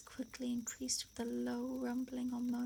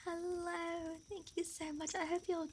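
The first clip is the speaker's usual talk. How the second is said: delighted greeting; 6 semitones higher, 3 dB quieter than usual. Real, not acted. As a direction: welcome friends in a high, gushing lilt. Streamer greets an incoming raid.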